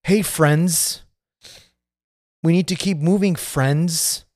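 lightly imitated condescending park worker; mock patronizing. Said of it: "is so patronizing".

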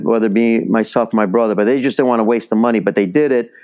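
ordinary delivery

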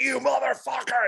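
high, strained growl of rage; enraged retold yell. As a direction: snarl it as if throttling someone, pitch way up. Acts out yell while choking attacker.

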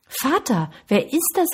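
put-on high-pitched boy's question; puzzled boy. A narrator's reading. Boy asks his father about the voice, high puzzled question. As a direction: ask it high, as a child wanting to know. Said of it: "wollte der Junge wissen".

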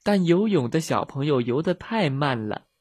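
usual speaking voice

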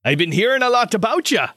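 hearty armorer character. Acted. Armorer recognizing one of the little fellas, hearty and animated. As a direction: greet a newcomer with lively delight. Game master voices the surgeon.

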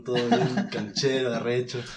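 normal talking voice

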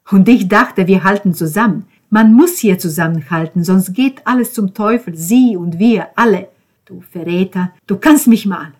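loud, betrayed tirade; heated, forceful accusation; worked-up rant. Acted. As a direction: rage at a friend who let you down. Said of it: "Ben regte sich wieder einmal auf".

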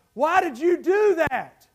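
loud, high outraged question; dying frog. Acted. Drowning frog to the scorpion, acted outcry. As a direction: cry it out as a betrayed victim. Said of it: "one of the last things it said was".

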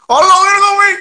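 high, loud hello; loud greeting. Real, not acted. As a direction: call out a loud hi. Loud greeting to each other.

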